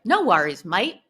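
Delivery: cheerful Aussie "No worries, mate"; Australian accent. A narrator's reading. Schubert reassuring Big Benny in an Australian accent. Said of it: "Schubert replies in an Australian accent".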